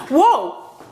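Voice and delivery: loud sudden whoa; startled whoa. Real, not acted. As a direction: react as something almost topples. Furniture nearly falls.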